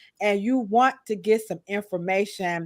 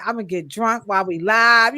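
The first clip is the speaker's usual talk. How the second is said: ordinary talk, nothing marked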